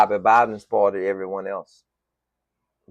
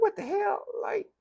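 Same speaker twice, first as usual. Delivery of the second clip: incredulous; 7 dB quieter than usual. Retold or imitated. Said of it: "I'm like".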